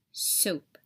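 plain reading voice

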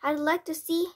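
loud, high, defiant challenge; blustering defiance. A narrator's reading. Father dares the witch, loud blustering defiance. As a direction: puff up and dare her. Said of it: "puffing out his chest".

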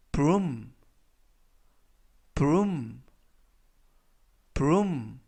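"broom" with a long u sound, falling; double o as "oo". Shown as a demonstration. Teacher showing the oo vowel, broom with a long u. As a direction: model "broom", the oo said like Korean 우. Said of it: "우 발음이 납니다".

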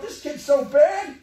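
voiced loud and high as an exasperated mom; shouting out mom's frustration with a bad kid; mom's exasperated thought. Mom thinking about a bad kid.

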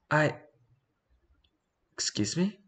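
high, startled gremlin squeak; chaotic gremlin voice. Acted. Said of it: "I'm gonna give him the chaotic gremlin voice".

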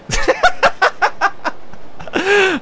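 shrill burst of laughter, falling away; a sudden high laugh that drops off; high-pitched laugh. Real, not acted. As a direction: laugh high and let it tumble down.

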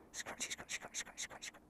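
whispered cooing to a sheep; hushed affectionate whisper. Real, not acted. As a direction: breathe the words softly while scratching. Scratching a sheep, murmuring.

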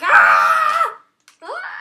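loud crying scream of Ah; hammed-up anguished yell; mock cry scream. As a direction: howl out as if in agony. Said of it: "I was like".

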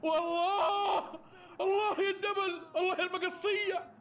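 high, drawn-out cries of delight; delighted praise. Real, not acted. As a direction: savour the goal with joy. Praising the goal replay.

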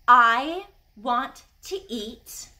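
very slow, each word spaced out; slow classroom model sentence; slow, deliberate model phrase. Shown as a demonstration. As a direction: say it slowly for the class to copy. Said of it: "Now maybe you can say".

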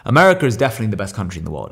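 ordinary talk

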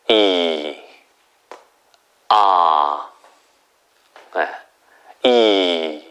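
"이" low, dipping and rising; third tone. Shown as a demonstration. Teacher demonstrating low third tone to students. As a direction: start "이" low and dip before rising. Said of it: "일성보다 낮은 곳에서 내려가면 돼요".

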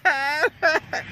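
high, very slow, whiny plea; begging road rescue in a hammed-up wail, high and slow; mock crying. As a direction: stretch it out like a crying child. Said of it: "Shut that crying up".

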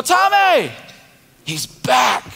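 high, loud excited call; excited disciples. Retold or imitated. Disciples telling Thomas the news, excited and high. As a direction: burst in with the big news. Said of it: "So they go".

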